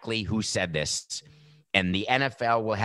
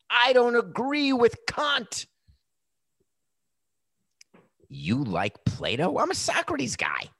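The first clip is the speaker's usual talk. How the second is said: mocking high voice; 14 semitones higher, slower than usual. Mocking philosophical dispute.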